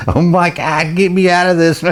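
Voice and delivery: high-pitched imitation of a bawling kid; hammed-up wail through the storyteller's amusement; mock bawling. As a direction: imitate a panicked child begging out, high. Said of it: "and just starts bawling".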